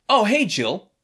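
imitating overly casual hellos; awkwardly casual. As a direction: act out exes hiding it at a party. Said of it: "There is a weird energy".

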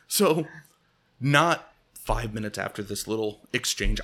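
usual speaking voice

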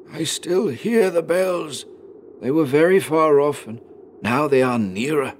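high, labored dying-man voice; slow, pained insistence. A narrator's reading. Dying father still hears bells, slowly and painfully. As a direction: draw it out painfully, still hoping. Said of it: "slowly and painfully".

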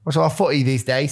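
quick, lightly put-on British accent. Showing off British slang to a friend, British accent. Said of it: "stayed with the British accent".

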